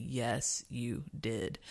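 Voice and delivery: quiet, calm inner voice; a hint of a soft voice from above. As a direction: answer like a calm voice within. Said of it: "I've heard very distinctly".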